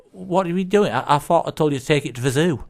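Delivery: high, fast, incredulous scolding; lightly acted officer in disbelief, pitch up; exasperated policeman. Policeman finding the bear still in the car, exasperated. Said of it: "a policeman sees the same car".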